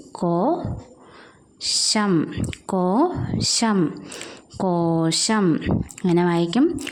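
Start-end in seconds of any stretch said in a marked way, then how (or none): 0.1-3.9 കോ and ഷം very slow and separate; slow syllables. Shown as a demonstration. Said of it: "syllable by syllable for children". 4.6-5.6 കോശം stretched slowly; slow word. Shown as a demonstration. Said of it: "joined slowly".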